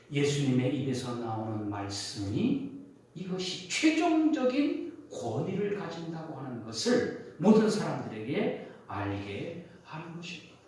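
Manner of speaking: ordinary talk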